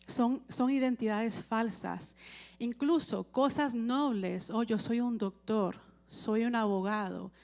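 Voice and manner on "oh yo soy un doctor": normal talking voice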